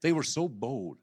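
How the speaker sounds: ordinary delivery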